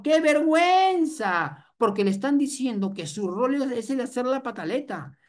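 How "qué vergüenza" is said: high, slow, hammed-up cry of shame; mock mortified mother. Mother to the doctor, hammed-up high cry of shame. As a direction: wail it like an embarrassed mom.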